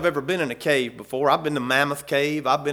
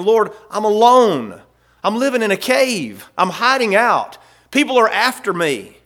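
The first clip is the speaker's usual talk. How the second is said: pleading prayer voiced for David; 6 semitones higher, 6 dB louder than usual. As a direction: cry out alone to the Lord.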